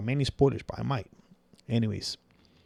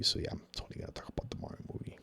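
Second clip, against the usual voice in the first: rushed unvoiced whisper; quick conspiratorial whisper to the viewers; fast whispered aside; 13 dB quieter, much faster than usual. Real, not acted. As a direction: rattle it off under your breath. Muttered aside after loud line.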